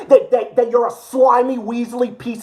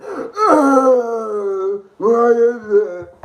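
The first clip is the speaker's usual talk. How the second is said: mocking fan voice; 3 semitones higher than usual. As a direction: parody the fans in a crying whine.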